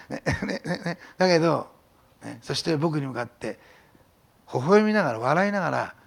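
ordinary delivery

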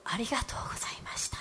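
faint, soft whisper of thanks; very soft, breathless whispered thanks; father's whisper. Retold or imitated. Father's thanks retold again.

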